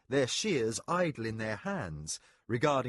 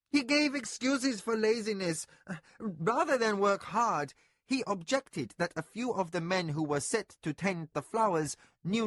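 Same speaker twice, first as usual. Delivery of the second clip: anxious overseer; 7 semitones higher than usual. A narrator's reading. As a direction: explain yourself nervously to your mistress. Servant voice held.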